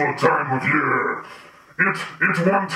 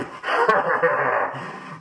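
monster cackle. Acted. Monster character laughing.

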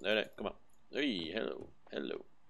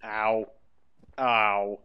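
pain yelp; 12 dB louder than usual. Real, not acted. Character keeps getting hurt.

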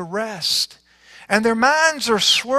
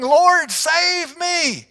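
higher, louder call for help; desperate cry; 11 semitones higher, 4 dB louder, much slower than usual. A narrator's reading. Peter sinking in the waves, calling desperately to Jesus. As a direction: cry out as you start to sink. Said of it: "Peter cried out".

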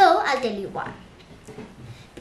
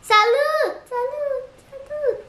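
high sing-song greeting; 12 semitones higher than usual. Shown as a demonstration. Teaching viewers how to say hi, sing-song. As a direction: chirp it like a little echo.

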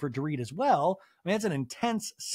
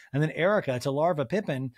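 usual speaking voice

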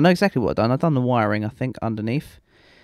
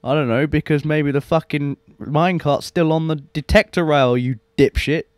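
mock scolding; 4 semitones higher than usual. Real, not acted. Scolding his past self.

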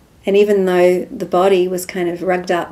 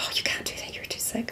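hushed worry; 4 semitones higher, 11 dB quieter, faster than usual. Retold or imitated. Friend's worried objection retold.

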